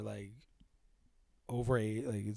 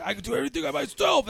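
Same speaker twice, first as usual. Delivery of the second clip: 1 stubborn mimicry; 11 semitones higher, 3 dB louder, faster than usual. Mimicking the stubborn cripple character.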